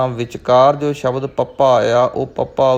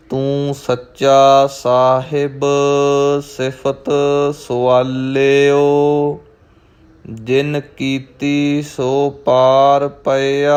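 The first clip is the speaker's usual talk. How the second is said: slow, drawn-out scripture reading with long line endings; Gurbani recitation; 3 semitones higher, 4 dB louder, much slower than usual. Shown as a demonstration. Teacher models the verse for learners, slow measured recitation. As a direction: recite it slowly, holding the final vowels.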